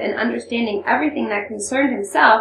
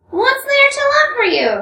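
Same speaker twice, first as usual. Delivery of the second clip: a loud, much higher spiteful snarl; 6 dB louder than usual. Acted. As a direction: spit it back with open spite. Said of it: "Rakitin snarled".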